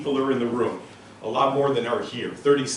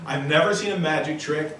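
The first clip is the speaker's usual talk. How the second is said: normal talking voice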